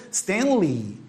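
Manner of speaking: Stanley ending in a long "ee". Shown as a demonstration. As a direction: say Stanley as STAN-lee. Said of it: "a pronúncia desse E Y é um I longo".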